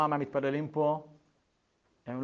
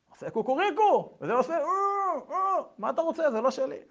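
whining denial; 11 semitones higher than usual. Acted. Zelig denies, whining.

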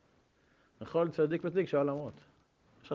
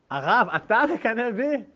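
amazed student; 8 semitones higher, 8 dB louder, slower than usual. Retold or imitated. Student amazed at rebbe's envy.

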